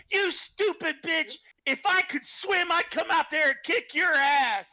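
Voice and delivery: high, loud furious yell for a punchline; angry yell. Acted. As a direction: holler it across a field, outraged. Acted angry yell from roadside.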